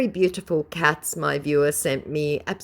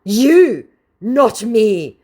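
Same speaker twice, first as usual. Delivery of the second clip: high, loud mock snarl, slowing at the end; 7 semitones higher, 8 dB louder, much slower than usual. Vlogger hamming up the scammer's snarl. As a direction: snarl it high and loud, drawing out the last word. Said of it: "snarled the scammer".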